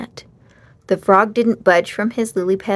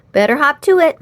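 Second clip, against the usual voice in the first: higher, louder brisk mom; Mom's voice; 6 semitones higher, 6 dB louder, faster than usual. A narrator's reading. Mom tells Henry to hurry before the rain. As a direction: hurry him along, motherly.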